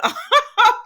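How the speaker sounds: sudden high, loud laugh; burst of laughter. Retold or imitated. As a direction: crack up out loud mid-quote. Laugh inside quote.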